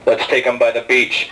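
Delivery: very low, slow mock voice of a tipsy buddy; drunk friend's voice. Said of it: "and goes".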